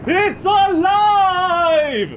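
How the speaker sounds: high drawn-out mad scientist scream; triumphant shout. Acted. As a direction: howl your triumph, very slowly. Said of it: "Igor, quickly".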